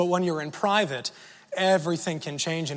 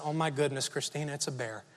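much softer, low hushed warning; hushed alarm; 4 semitones lower, 9 dB quieter than usual. Retold or imitated. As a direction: say it under your breath, scared of being heard. Spotting a bear, telling wife.

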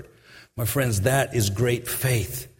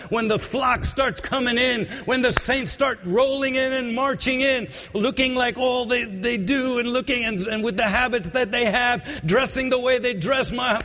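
high, sung-out preaching over music; preaching at full force; 10 semitones higher than usual. Acted. Preacher singing out to the congregation, full force. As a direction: lift it into a chanting preacher's cry.

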